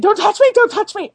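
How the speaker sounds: high, frantic don't-touch-me; reliving a meltdown on the cliff, panicked; panicked plea. Retold or imitated.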